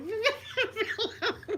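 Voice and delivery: high giggling laugh; helpless laughter. Real, not acted. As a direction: dissolve into giggles. Real laughter at the story.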